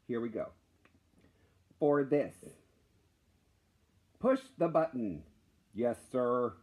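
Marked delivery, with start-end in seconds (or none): none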